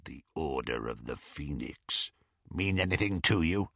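low, quiet growl. A narrator's reading. Werewolf questioning captive Ron, low growl. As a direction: probe with a soft growl. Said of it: "growled Greyback".

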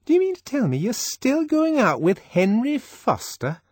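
high, scandalised Fanny; disapproving astonishment. Acted. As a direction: pitch it way up, shocked and disapproving. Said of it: "expression of pained and disapproving astonishment".

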